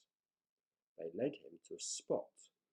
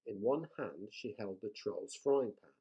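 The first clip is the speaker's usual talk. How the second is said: ordinary delivery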